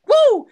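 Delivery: high, loud party whoop; loud excited show-opening whoop; hype whoop. Acted. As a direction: whoop to pump up the crowd. Host hypes the show opening.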